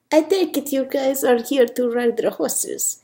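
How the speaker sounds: put-on rolling into a careful Spanish accent. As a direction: chat in an attempted Spanish accent. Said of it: "Sophia's got a bit of a Spanish accent".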